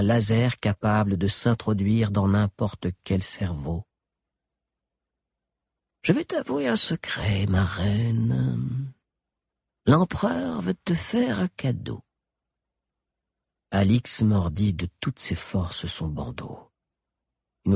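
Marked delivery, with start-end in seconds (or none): 6.0-12.0 high, hushed creepy doctor; sinister confiding doctor. A narrator's reading. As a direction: lean in and confide the secret. Galien leans over, confiding secret.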